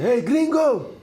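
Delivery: high, loud mocking yell; shouted insult. Street vendor yelling at two departing foreigners, loud and high. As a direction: yell it after them across the street.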